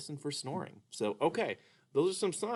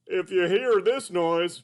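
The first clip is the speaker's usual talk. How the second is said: high, slow cartoon puppet voice; Mister Dolphin puppet; 6 semitones higher, 5 dB louder, slower than usual. Puppet greets the kids as Mister Dolphin, high and slow. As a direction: play a sleepy, sing-song puppet. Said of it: "Mister Dolphin".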